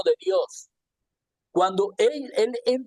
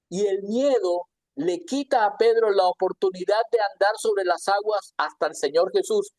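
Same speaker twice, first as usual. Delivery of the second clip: ordinary talk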